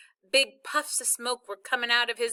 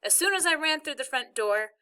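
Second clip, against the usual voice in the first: ordinary delivery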